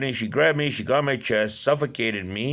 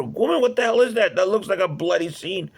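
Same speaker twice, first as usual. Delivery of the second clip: high, louder, alarmed outburst; alarmed confrontation; 8 semitones higher, 3 dB louder than usual. Retold or imitated. Man back from bloody washroom confronting woman, high alarmed outburst. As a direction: demand an explanation in alarm.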